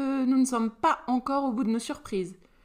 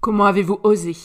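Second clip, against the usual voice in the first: outraged demand; 10 dB louder, faster than usual. A narrator's reading. Furious father confronts his son.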